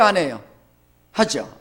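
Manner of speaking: usual speaking voice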